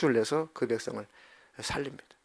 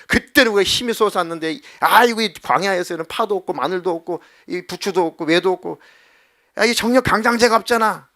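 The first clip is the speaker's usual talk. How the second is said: loud, higher nostalgic grumbling; whining complaint; 4 semitones higher, 6 dB louder than usual. Acted. Israelites pining for Egypt's leeks and garlic, loud whining. Israelites lament lost vigor.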